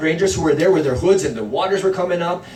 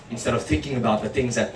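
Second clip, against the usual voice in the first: usual speaking voice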